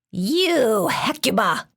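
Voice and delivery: slow, stern, scratchy monster voice. A narrator's reading. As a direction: press her coldly like a scratchy-voiced beast. Monster kicking the dog.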